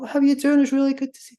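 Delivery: high-pitched, gushing greeting; voice pitch going up. Acted. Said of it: "Your voice pitch may go up".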